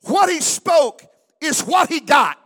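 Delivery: preaching shout. Real, not acted. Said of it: "I said".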